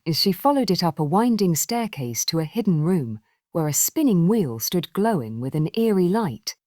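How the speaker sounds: usual speaking voice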